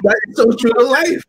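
drawn-out enthusiastic yes; excited agreement. Real, not acted. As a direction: pile on the agreement.

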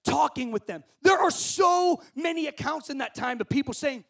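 loud, high, ringing sermon delivery; full-force preaching. Acted. Preacher to student campers, loud full-force preaching. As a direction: preach it out big to the whole camp.

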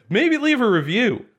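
aggressive. Real, not acted. Podcast host asking listeners for a review, aggressively. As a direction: push the request at them aggressively. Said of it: "You said that so aggressively".

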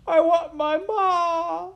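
slow, loud, very high wail; crying out. A narrator's reading. Maid wailing for her mother, high drawn-out cry. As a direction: bawl it like a lost child. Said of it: "cried Midge".

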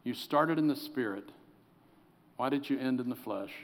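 soft sorrowful pastor; soft rebuke then love. Retold or imitated. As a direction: gently confront an old friend. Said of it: "I said".

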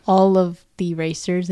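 normal talking voice

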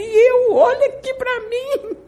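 high, loud, crying plea; retold sobbing plea, pitch way up; tearful plea. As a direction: cry out to God to be seen, voice breaking high. Pleading with God in tears.

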